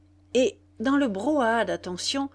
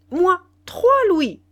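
high, loud auction bid; eager outbidding; 10 semitones higher, 9 dB louder than usual. A narrator's reading. Philippe outbids his friends on Nana, eager. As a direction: jump in and top the last bid.

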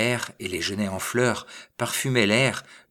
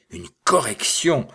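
raised, louder indignant echo; angry outburst; 6 semitones higher, 6 dB louder than usual. A narrator's reading. Little man bristling at the bourgmestre's threat, angry outburst. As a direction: throw the word back in anger. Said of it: "en bondissant de colère".

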